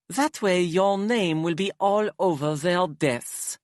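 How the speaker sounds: high, theatrical aristocrat; dramatic Hypnos voice. Acted. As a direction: flamboyant and weary, sighing exaggeratedly.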